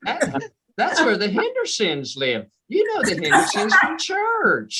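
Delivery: folksy country Georgia GPS giving directions; country Georgia accent. Acted. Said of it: "the directions would be all country".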